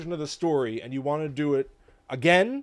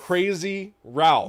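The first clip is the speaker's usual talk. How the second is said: dramatic proclamation; 6 semitones higher, 6 dB louder, much slower than usual. Pretend. Hammed dramatic name drop.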